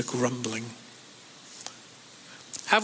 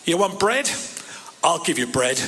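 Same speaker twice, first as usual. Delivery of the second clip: exasperated mock God. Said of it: "what tone of voice the Lord was using".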